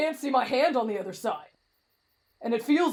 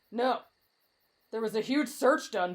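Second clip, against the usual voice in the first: usual speaking voice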